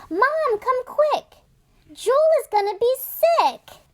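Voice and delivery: high, loud urgent call; girl calling mom to save the puppy, high and loud. Acted.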